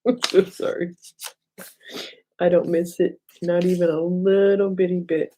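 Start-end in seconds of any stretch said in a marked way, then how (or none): 0.0-1.0 amused, laughing fake sympathy; laughing apology. Real, not acted. Teasing Kathy about getting snow, laughing apology.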